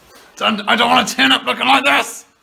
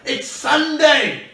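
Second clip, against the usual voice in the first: drawn out, higher-pitched exclamation; exasperated; 6 semitones higher, much slower than usual. Real, not acted. Correcting a dingbat about the closed dentist, exasperated. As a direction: stretch it out in exasperation.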